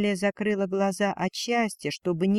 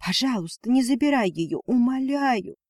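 higher-pitched, imploring plea; pleading; 6 semitones higher than usual. A narrator's reading. Mother begging husband to leave her the child, pleading. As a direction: beg him, calmer but urgent. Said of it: "уже спокойнее сказала женщина".

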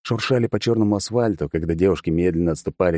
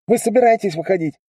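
impatient call; 14 semitones higher, 4 dB louder than usual. Acted. Drone voice impatient.

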